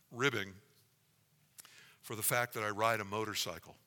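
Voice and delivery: usual speaking voice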